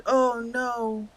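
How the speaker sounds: ordinary talk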